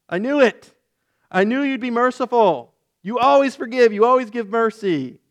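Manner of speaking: high whiny sulk; petulant. Retold or imitated. Jonah whining to God about His mercy, high and sulky. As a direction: complain like a spoiled child.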